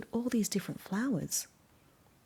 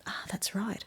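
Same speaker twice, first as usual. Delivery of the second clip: soft breathy aside; whisper; 8 dB quieter than usual. Real, not acted. Reader quietly agreeing with the children, whispered.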